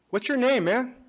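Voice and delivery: bright, high, loud greeting to a stranger; forced friendly hello to the driver; cheery hello. Retold or imitated.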